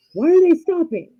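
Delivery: high, loud alarmed question; acted alarm. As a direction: imitate a husband suddenly panicking. Acts husband alarmed at stopped car.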